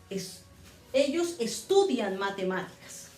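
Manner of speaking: ordinary talk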